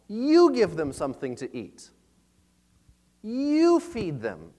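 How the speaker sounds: "You" hit hard, slowing on "You feed them"; emphatic "You", drawn out; stress on YOU. Acted. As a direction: lean on "You" as a command to the disciples. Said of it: "the you is emphasized".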